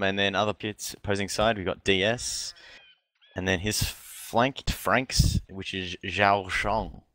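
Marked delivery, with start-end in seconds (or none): 6.0-7.0 Zhao Shong said with Chinese tones; Chinese pronunciation. Shown as a demonstration. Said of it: "My Chinese accent is superior".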